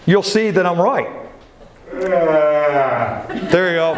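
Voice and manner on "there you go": words stretched through a slow yawn; drawn-out yawning voice. Shown as a demonstration. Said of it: "Try it".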